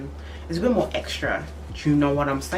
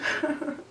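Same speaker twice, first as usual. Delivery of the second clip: high giggle; 10 semitones higher, 4 dB quieter than usual. Real, not acted. Laughs at own joke.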